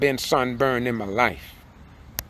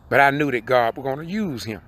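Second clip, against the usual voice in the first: normal talking voice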